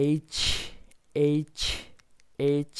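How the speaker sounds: এইচ said as "aitch", gliding "ay" then "ch"; এইচ repeated loudly with a clear "ay" glide; এইচ stressed, ending in a firm "ch"; correct letter H. Shown as a demonstration. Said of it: "এটার উচ্চারণ হবে".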